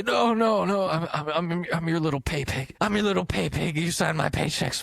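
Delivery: hammed-up high groveling; eager submissive. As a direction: insist you're his pay pig. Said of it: "Is it the pay?".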